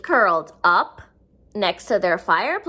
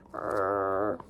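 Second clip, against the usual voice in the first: low rolling rrr purr in the throat; cat purr; 9 dB quieter than usual. Shown as a demonstration. Showing the children how a cat purrs, deep rolling purr. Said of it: "Purring is what cats do, like".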